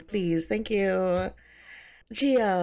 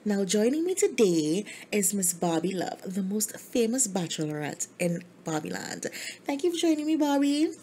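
bubbly host; 2 semitones higher than usual. Acted. Gia opens her show and welcomes Barbie, gushing. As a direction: welcome the audience like a celebrity host.

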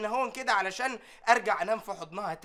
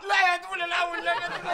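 higher, desperate begging no; pleading; 6 semitones higher than usual. Acted. Servant begging boss not to shoot the buffalo, pleading. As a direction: beg him to spare her.

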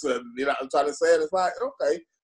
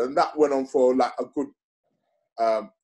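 ordinary delivery

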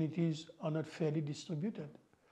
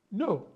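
imitating an emphatic, higher-pitched "No"; emphatic denial; 5 semitones higher, 4 dB louder than usual. Mauritian answering whether he is a communalist, emphatic. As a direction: quote a firm denial, pitched up on "No". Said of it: "he will say emphatically".

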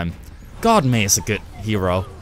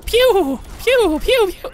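1 mock gun noises; 4 dB louder, much slower than usual. Real, not acted. Imitating own gun.

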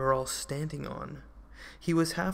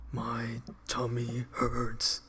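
a slow, whimpering, lower-pitched giant moan; sobbing; 3 semitones lower, much slower than usual.

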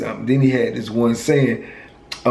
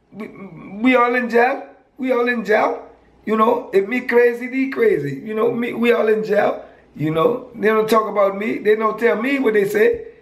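put-on Virgin Islands accent pitched way up, dismissive; high-pitched Virgin Islands accent; 8 semitones higher than usual. Friend explaining why his people shun him, in a high Virgin Islands accent. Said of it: "I liked his accent".

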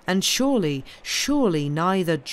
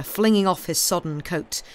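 usual speaking voice

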